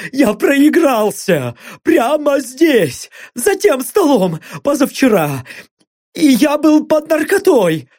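high, frantic begging; panicked pleading. Acted. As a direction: beg for your life. Blond begs under torture.

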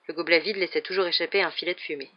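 ordinary delivery, nothing marked

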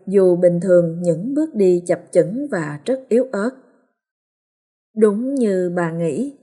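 usual speaking voice

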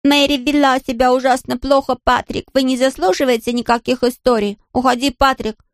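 high mock scolding nanny; child's hammed-up nanny impression; imitating nannies. A narrator's reading. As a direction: mimic a cross nanny in a high voice. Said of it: "принялся подражать голосам нянь".

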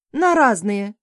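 a loud, curt bark; a loud, curt shout to end the argument; barked reply. A narrator's reading. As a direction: cut the talk short with a bark. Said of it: "рявкнув".

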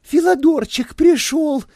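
high, loud thrilled nymph voice; rustling excited gasp. A narrator's reading. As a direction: gush over him breathlessly. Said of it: "прошелестела нимфа".

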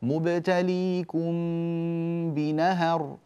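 very slow, hidden meem before بهدية; drawn-out recitation with the meem hidden; nasal hold on the meem. Shown as a demonstration. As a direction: hum the meem of إليهم into بهدية. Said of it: "كذلك في كلمتين".

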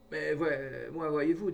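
hearty insistence. A narrator's reading. Father Morue winning over the priest at dinner, hearty insistence. Hearty peasant declares himself reconciled.

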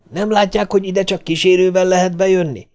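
high, strained outburst; irate engineer berating intruders; angry yell. A narrator's reading. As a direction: yell it high, without full volume. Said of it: "üvöltötte".

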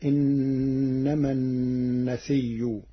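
النسي with a doubled ya, no final hamza; Warsh reading. Shown as a demonstration. Teacher chanting the Warsh form النسي. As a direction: say النسي as an-nasiyyu, slow and drawn. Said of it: "فتصير".

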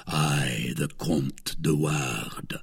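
low, slow whisper; subdued whisper. A narrator's reading. D'Artagnan pretends to be de Wardes, whispering low. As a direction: breathe the false name low. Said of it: "said D'Artagnan in a subdued voice".

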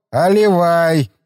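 put-on slow, drawn-out drunk demand; drunken slur. Drunk assistant insisting on a refill, slow drunken slur. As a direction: stretch it out like a drunk. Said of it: "На пьяном, на пьяном языке".

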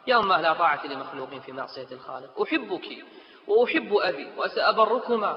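ordinary delivery

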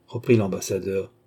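ordinary delivery

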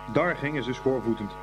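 plain reading voice

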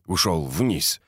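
plain reading voice

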